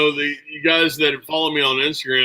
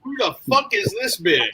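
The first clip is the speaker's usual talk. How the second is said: jealous wife; 8 semitones higher than usual. Retold or imitated. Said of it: "my wife's looking at me just going".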